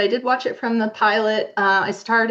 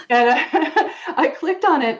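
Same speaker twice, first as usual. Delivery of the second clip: laughing; 7 semitones higher, slower than usual. Real, not acted. Laughing while retelling.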